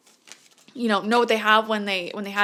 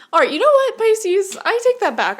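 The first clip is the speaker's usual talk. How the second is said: excited self-correction; 11 semitones higher, 5 dB louder than usual. Real, not acted. Reader excitedly retracts her reading.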